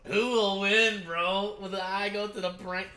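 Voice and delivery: loud, high, excited yelling; hyped fight commentary. Real, not acted. Viewer yelling at the eye-gouging fight, loud and hyped. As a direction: shout like a fan at a fight.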